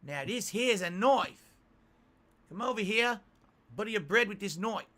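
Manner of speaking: high, slow Crocodile Dundee Australian accent; bad Australian accent. Shown as a demonstration. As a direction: ham up a terrible Australian accent. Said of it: "best Australian accent".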